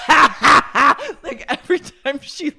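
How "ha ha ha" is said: loud, high mock cackle; imitated laugh. Podcaster imitating the cult leader's laughter, loud mock laugh. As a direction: cackle loudly, imitating someone's odd laugh. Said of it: "her laughter".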